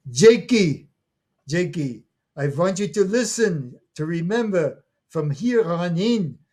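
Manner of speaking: put-on high, loud grandmother lecturing the boy; insistent grandmother.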